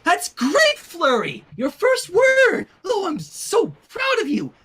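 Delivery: high, loud, gushing praise; proud exclaiming. A narrator's reading. Twilight gushing over Flurry's first word, high and loud. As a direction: cheer her baby's first word. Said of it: "Twilight exclaimed".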